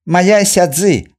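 slow, high, loud self-introduction; proud announcement. A narrator's reading. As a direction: announce your name proudly. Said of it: "объявил он".

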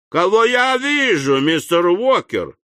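high, bright mock delight; joyful exclamation. A narrator's reading. Job greeting Sam with put-on joy. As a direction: gush with feigned surprise. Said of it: "радостно встрепенувшись ... воскликнул".

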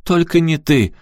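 high, distressed disbelief; pained, high-pitched wail; upset. A narrator's reading. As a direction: protest in dismay. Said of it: "расстроилась мать".

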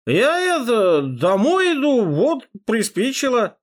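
put-on high, slow slurred drunk muttering. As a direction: ramble like a harmless drunk. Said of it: "заплетающимся языком пробормотал я".

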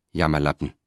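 understated, contained anger; angry. A narrator's reading. Wife insults her faltering husband, mildly angry. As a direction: throw the insult with restrained anger. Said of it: "sagte Terentia wütend".

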